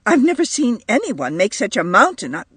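quick, high, indignant retort; rising temper. A narrator's reading. Edith protests to the angry mother, temper rising. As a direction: rattle it out crossly until cut off. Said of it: "her own temper rising".

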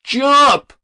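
high, loud urging cry; lone shout. A narrator's reading. Young voice from the crowd to the boy, shouting. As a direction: shout it up to the roof. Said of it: "shouted a lone word to the boy on the roof".

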